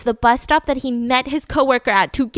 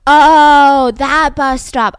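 loud, drawn-out sarcastic "oh"; mock realization; 4 semitones higher, 11 dB louder, much slower than usual. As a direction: play the officer suddenly getting it, hammed-up. Police mock sudden realization.

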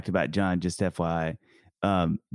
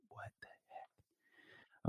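breathless slow whisper, much softer; bewildered whisper; 20 dB quieter, much slower than usual. Retold or imitated. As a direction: whisper it, baffled, to yourself. Imagined daughter at the funeral, bewildered.

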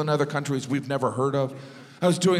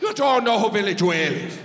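higher, incredulous Irish voice; Irish accent; 5 semitones higher than usual. Retold or imitated. Sound guy to the clueless American, incredulous Irish accent. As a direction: put on an amazed Irish accent. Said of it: "And he goes".

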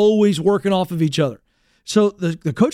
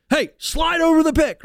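high, urgent coach impression; coach correcting; 10 semitones higher than usual. Coach answering his player, imitated urgent correction. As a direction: give a player a mid-game pointer. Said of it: "He's correcting me real quick in game".

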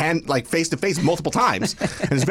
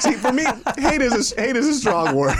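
high, laugh-filled talk; 11 semitones higher than usual. Real, not acted. Co-host joking about hate being strong, laughing hard. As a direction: push the words out through laughter.

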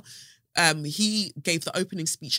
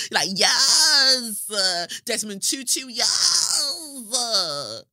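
shouting yes through laughter; mock them, half laughing; mock cry laughing; 5 semitones higher, 5 dB louder, much slower than usual. Real, not acted. Mocking oppressors' favourite figures.

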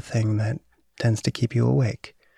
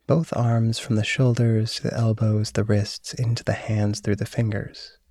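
guided meditation. Acted. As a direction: guide them into calm and sleep. Leads a body relaxation.

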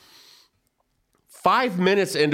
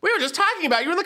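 much higher, laughing gush; starstruck blurt; much faster than usual. Retold or imitated. Fan gushing to Billy Zane, high laughing blurt. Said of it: "my dumb ass".

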